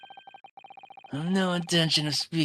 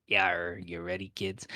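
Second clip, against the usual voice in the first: pirate growl; 5 semitones lower, 3 dB quieter than usual. Pretend. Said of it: "I guess I'm being a pirate".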